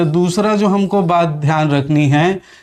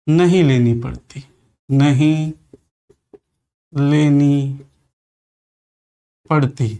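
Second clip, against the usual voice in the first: अन्य वर्ण की, सहायता, नहीं लेनी पड़ती in spaced chunks with long gaps; dictating slowly for notes; slow dictation; 3 semitones lower than usual. Shown as a demonstration. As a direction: dictate the phrase in pieces. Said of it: "अन्य वर्ण की".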